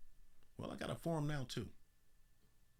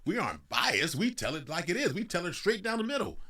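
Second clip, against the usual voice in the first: louder, rising indignant denial; mocking the media; 7 semitones higher, 6 dB louder than usual. Mocking journalists denying bias, hammed-up indignant. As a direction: play the offended press, pompous. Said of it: "the media got upset".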